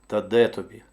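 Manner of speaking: ordinary delivery, nothing marked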